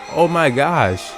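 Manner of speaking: usual speaking voice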